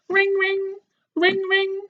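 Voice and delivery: high sung-out ring ring like a phone. Acted. Phone rings in story.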